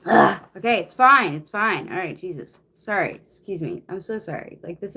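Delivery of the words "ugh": loud exasperated ugh; loud fed-up groan; frustrated groan. Real, not acted. Frustrated groan mid reading.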